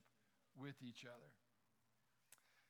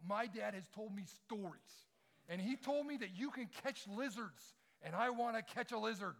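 put-on a touch higher and louder, eager kid pleading; eager little boy; 5 semitones higher, 6 dB louder than usual. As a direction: lightly play a keen little boy asking a favour. Little boy asks to catch lizards.

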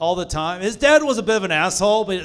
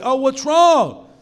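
mock concern; 6 semitones higher, 3 dB louder than usual. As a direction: coo at the crybaby in mock pity. Mock concern for crying friend.

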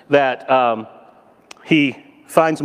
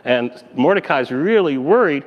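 normal talking voice

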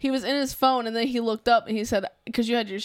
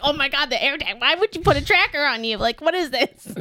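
repeat rant; 5 semitones higher than usual. Retold or imitated. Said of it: "I hit the repeat button on my rant".

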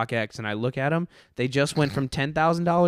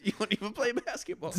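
higher, softer voice, laughing through the quote; laughing mimicry; 11 semitones higher, 7 dB quieter than usual. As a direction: laugh while parroting the dad's put-down. Laughing retelling friend's dad line.

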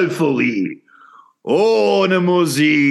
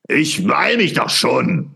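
ordinary talk

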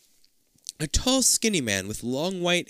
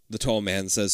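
plain reading voice

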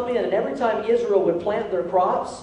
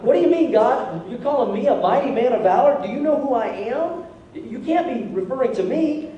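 higher-pitched protest, rising questions; incredulous, pitched-up retelling; acted disbelief; 4 semitones higher than usual. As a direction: question it as if it can't be you. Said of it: "He's like".

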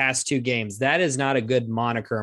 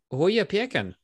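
put-on high, playful Boston accent; 7 semitones higher than usual. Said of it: "You don't like my Boston accent?".

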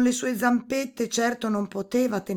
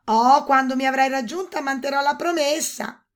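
high, loud princess calling back; dismissive princess; 7 semitones higher, 8 dB louder than usual. A narrator's reading. Princess calling back to the toad, dismissive high voice.

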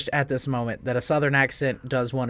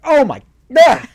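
mock shock; 10 semitones higher, 13 dB louder than usual. Retelling a shocked glance.

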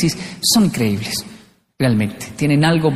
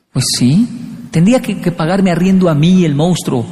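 ordinary talk

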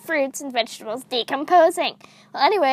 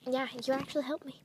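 normal talking voice

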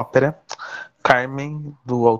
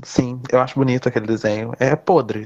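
ordinary talk

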